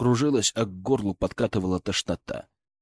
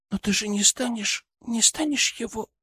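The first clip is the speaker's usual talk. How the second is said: put-on high pleading girl; 10 semitones higher than usual. Daughter voiced.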